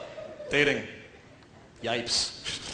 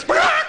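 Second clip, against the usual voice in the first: sudden high, loud bawk; chicken squawk; 7 dB louder than usual. Acted. Chicken losing its nuggets, squawking. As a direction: let out one startled chicken squawk.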